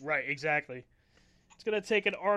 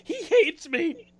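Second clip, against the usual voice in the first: high slow mock whine; 11 semitones higher, 8 dB louder, much slower than usual. Mock fan heartbreak.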